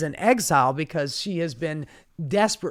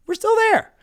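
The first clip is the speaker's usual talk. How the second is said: "there" yelled much higher and louder in disbelief; high mocking shout; 6 dB louder than usual. Shown as a demonstration.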